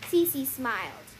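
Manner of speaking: ordinary delivery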